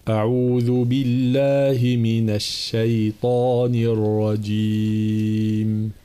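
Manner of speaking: slow melodic chant; slow Quranic chant; Quran recitation. Acted. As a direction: recite slowly and reverently. Recites isti'adha.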